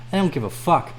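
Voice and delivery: ordinary delivery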